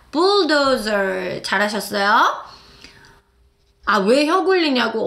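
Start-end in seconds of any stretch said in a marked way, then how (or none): 0.1-1.4 bulldozer very slow with a curled r sound; rolled bulldozer. Shown as a demonstration. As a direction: roll through bulldozer slowly. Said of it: "시작".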